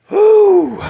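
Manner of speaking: loud, high exhale of "whew"; big spent "hoo"; worn-out whew. Real, not acted. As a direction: blow out relief after an exhausting tale. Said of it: "I wore myself out telling that story".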